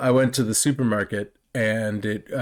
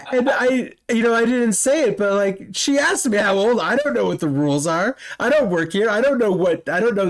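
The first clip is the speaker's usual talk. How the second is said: venting how absurd the cashier was; exasperated rant; 8 semitones higher than usual. Real, not acted. Telling his friend about the cashier, high exasperated rant.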